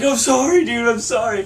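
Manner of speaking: high pleading sorry; apologetic drunk. Retold or imitated. Drunk friend apologizing to stranger, high and pleading. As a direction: beg forgiveness in a high whine.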